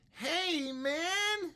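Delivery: small student mimicking back; student's echo. Shown as a demonstration. Student echoes teacher's greeting, high. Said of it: "if that wasn't clear".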